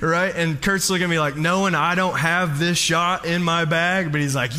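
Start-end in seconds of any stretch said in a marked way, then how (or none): none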